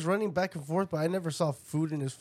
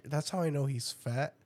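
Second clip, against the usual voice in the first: ordinary delivery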